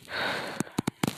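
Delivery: long unvoiced weary sigh; soft sigh. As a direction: let out a quiet tired sigh. Sad hero before answering.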